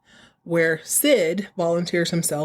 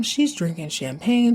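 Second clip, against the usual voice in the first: normal talking voice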